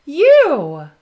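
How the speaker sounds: high and loud, beaming at the listener; excited call-out. Acted. Dora telling the child viewer they're her best friend, joyful and loud. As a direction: land the final word with a big joyful lift.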